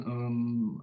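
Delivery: ordinary talk, nothing marked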